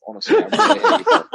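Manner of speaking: high, loud, fast, through laughter; laughing outburst. Real, not acted. Fed-up co-host jokingly scolding the other two, laughing outburst. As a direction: burst out laughing on the words.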